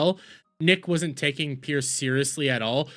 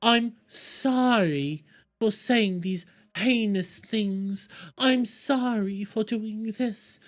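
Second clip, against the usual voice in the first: slow, higher, pleading sorry voice; hammed-up slow apology, pitched up; mock grovelling apology; 5 semitones higher than usual. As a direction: whine each sorry slowly like a guilty kid. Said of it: "expecting Nick to come in and go like".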